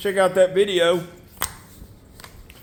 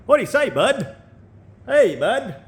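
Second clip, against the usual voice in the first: put-on high, swooping Canadian accent; hammed-up Canadian buddy greeting; mock Canadian accent; 8 semitones higher than usual. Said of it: "I can't sound Canadian".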